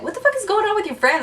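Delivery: high, fast incredulous question; mock bafflement. [name] asks about her friend, mock bafflement. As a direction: imitate a baffled guy, rushing it.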